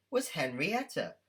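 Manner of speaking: plain reading voice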